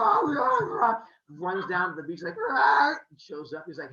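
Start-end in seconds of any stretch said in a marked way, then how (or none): 0.0-0.9 high, loud cry to get running; urgent mock shout. Zeus rushing off after a hot girl, urgent shout. 2.5-2.9 high, loud running "ah"; comic yell. Retold or imitated. Zeus runs down to the beach, comic yell.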